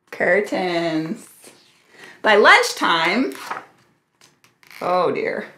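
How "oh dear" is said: low, understated duck grumble; mildly put-out storybook grumble; grumbling. A narrator's reading. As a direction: grumble it under your breath, lower. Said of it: "Sally grumbled".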